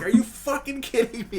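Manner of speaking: retold furious complaint, lightly voiced; imitating pissed off friend. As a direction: replay his angry disbelief. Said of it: "genuinely pissed off".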